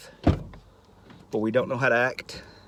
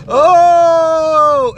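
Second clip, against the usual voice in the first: much higher, louder crowd ooh; 14 semitones higher, 11 dB louder than usual. Retold or imitated. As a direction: whoop it up at the burn. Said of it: "it's a little louder".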